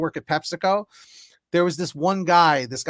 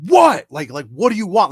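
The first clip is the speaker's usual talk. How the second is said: high, loud mock snap on a retort; retold bark, loudest on the first word; imitated angry yell; 4 semitones higher, 9 dB louder than usual. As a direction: yell it like an irritated coworker. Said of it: "he yelled at me".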